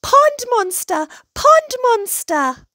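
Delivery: acted alarm yell; panicked cry. A narrator's reading. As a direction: shout a terrified warning. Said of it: "cried Hedgehog".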